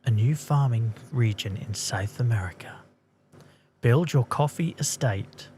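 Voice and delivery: measured voiceover read of the game announcement; trailer narration. Acted. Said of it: "let me get back into narration mode".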